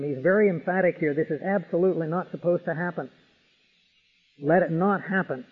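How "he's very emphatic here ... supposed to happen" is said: ordinary delivery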